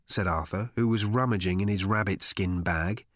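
ordinary delivery

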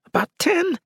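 very high squeaky answer. A narrator's reading. Cannibal informing his captor how many are upstairs, squeaky. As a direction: squeak it out like a pinned captive. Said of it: "said in a squeaky voice".

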